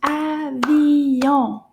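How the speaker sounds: "Avion" drawn out in three loud beats; "Avion" stretched and loud for clapping along; syllable by syllable. Shown as a demonstration. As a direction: chant "Avion" slowly, each syllable stretched. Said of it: "Let's do the syllables together".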